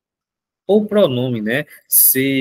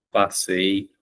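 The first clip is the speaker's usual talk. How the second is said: one word at a time, long pauses, fading; spaced-out dictation, trailing off; slow dictation; 5 semitones lower, slower than usual. Shown as a demonstration. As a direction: dictate each word for the class to copy. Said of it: "dictating example slowly".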